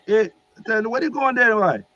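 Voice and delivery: high-pitched, loud Jamaican questioning; Jamaican accent. Retold or imitated. Archangel asks Gabriel where he's headed, Jamaican accent. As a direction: demand an answer in a high Jamaican voice. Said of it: "he had, like, this Jamaican accent".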